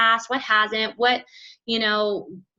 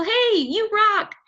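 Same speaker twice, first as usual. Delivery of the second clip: much higher, bubbly pitch; mock cheerful recruiter voice; upbeat invite; 9 semitones higher than usual. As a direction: sell it with sunny enthusiasm. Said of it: "We're telling people".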